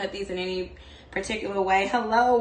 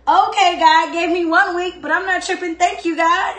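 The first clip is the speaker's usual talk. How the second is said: much higher and louder, bubbling over; excited thanks; 9 semitones higher, 7 dB louder than usual. Retold or imitated. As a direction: gush with joy at the warm week. Retelling her thankful reaction to the warm week.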